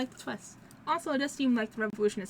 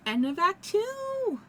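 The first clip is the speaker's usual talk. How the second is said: high, loud, slow drawn-out Italian-tinged proclamation; excited announcement; 9 semitones higher, 5 dB louder, much slower than usual. Real, not acted. Gamer closing the act for viewers, loud and drawn out. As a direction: shout the act ending like a showman.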